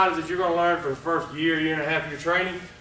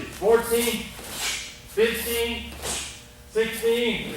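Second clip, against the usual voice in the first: counting kata; 7 semitones higher, much slower than usual. Shown as a demonstration. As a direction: call each count as you do the move. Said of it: "I'm gonna count it".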